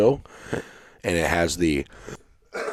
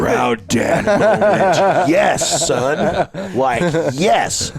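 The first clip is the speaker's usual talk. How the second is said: slow, higher and louder, laughing between words; proud laughing; 9 semitones higher, 6 dB louder, much slower than usual. Retold or imitated. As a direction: beam with laughter at your son's comeback.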